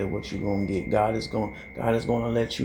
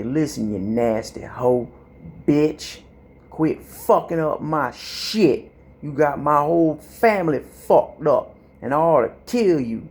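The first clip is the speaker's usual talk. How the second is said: cussing out; 3 semitones higher, 5 dB louder than usual. Pretend. Cussing out their own reflection in the mirror, loud fury. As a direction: tear into the reflection at full volume. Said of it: "You need to get dirty on that hoe".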